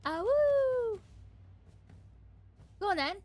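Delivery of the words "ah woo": high wolf howl "ah woo". Pretend. Viewer teasing the howl.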